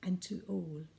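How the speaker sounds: lilting storybook reading of a Christmas poem; poem recital. A narrator's reading. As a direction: read it like a bedtime Christmas poem for the family. Reads Christmas poem to listeners.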